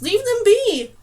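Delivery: loud, high yell; yelling at the archers to stop. A narrator's reading. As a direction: yell it across the beach. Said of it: "Dane yelled now to the humans".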